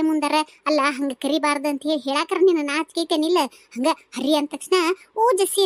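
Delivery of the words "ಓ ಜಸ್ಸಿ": mock sweet call. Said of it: "ಅಂತ ಹೇಳಿ ಬಂದೇ ಬಿಡ್ತೀಯಲ್ಲ".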